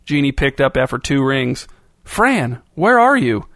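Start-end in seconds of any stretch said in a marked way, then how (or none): 2.0-3.5 high, urgent and startled; alarmed. Acted. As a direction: blurt it out in shock. Friend startled by missing Fran's call.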